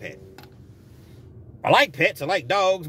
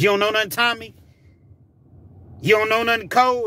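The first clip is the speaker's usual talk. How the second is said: comic interrogation; 8 semitones higher, 4 dB louder, faster than usual. Retold or imitated. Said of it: "Martin's walking around the table".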